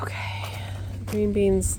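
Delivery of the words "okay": a breathless whispered okay. Real, not acted. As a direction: whisper it to yourself. Whispered to herself.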